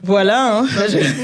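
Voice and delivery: slipping into a French accent with a laugh; a loud, laughing French "voilà, hein"; French-accented aside, laughing. Real, not acted. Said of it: "I don't know where the French accent is coming out".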